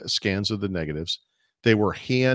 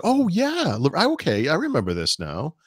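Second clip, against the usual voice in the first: sudden recognition; 8 semitones higher than usual. Retold or imitated. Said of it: "or it's like".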